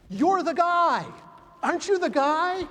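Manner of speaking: loud, high, excited call; excited accosting stranger. Retold or imitated. Stranger walks up to the speaker, loud excited recognition.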